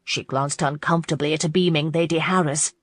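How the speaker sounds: plain reading voice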